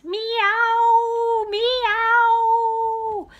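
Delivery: high, loud squealing meows; rhythmic yelping cat; bagpipe cat yelps. A narrator's reading. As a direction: squeal like bagpipes being pumped. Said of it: "as if he was playing the bagpipes".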